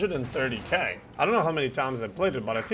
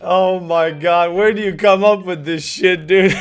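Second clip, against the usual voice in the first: laughing; 3 semitones higher, 9 dB louder than usual. Real, not acted. Cracking up at friend's joke.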